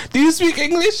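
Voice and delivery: squeaky falsetto mimicry; mock someone in a high squeal.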